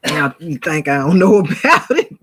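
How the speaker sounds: scoffing laugh through the question. Real, not acted. Mocking enemies who think she doesn't know, laughing. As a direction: laugh off the threat.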